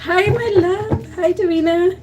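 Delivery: high, slow, drawn-out hello; high sing-song welcome; high sing-song greeting. Real, not acted. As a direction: greet the viewer sweetly, stretched out. Greeting viewers warmly.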